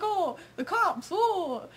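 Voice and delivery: high, slow "oh, the cops"; alarmed gasp. Retold or imitated. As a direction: spot the cop car, startled. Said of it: "We're like".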